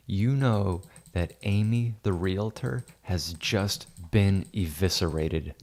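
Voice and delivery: slow, portentous pretend wizard; mock wizard proclamation. Wizard smites Amy the realtor with lightning, mock-grand. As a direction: proclaim doom slowly, drawing out words.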